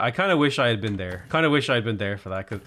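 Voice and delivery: usual speaking voice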